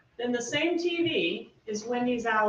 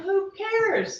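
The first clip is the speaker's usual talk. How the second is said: high, slow, dismissive "who cares"; mock shrug; 10 semitones higher, 5 dB louder than usual. Prosecutor voicing the defendant's dismissive attitude. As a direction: toss it off as if it doesn't matter. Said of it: "mocking the defendant's attitude".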